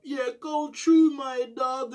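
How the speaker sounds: high, slow put-on voice of the cellmate; imitating homie. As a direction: stretch it out high and slow, mocking him. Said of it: "that's how he's going on".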